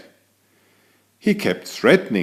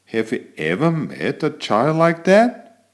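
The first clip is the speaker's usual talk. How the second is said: ordinary delivery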